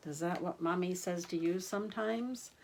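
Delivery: plain reading voice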